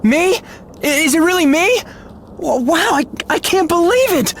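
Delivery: high-pitched, overwhelmed disbelief; can hardly believe your luck; stunned disbelief. Acted. Clemens hears he has been picked, stunned disbelief.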